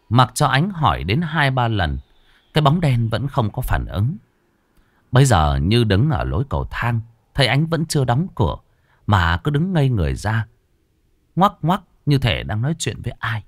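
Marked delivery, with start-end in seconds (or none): none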